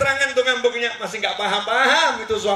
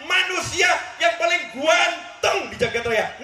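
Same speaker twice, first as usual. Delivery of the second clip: higher and slow, dragging each word out in awe; drawn-out exclamation; 7 semitones higher, slower than usual. Real, not acted. Preacher marvels to the mothers at the Prophet's looks, slow and high. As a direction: stretch it out in wonder.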